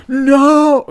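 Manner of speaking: long, high, loud wail of horror; dramatic cry. Retold or imitated. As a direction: belt out a drawn-out, melodramatic no. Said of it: "Kind of, like, very dramatic".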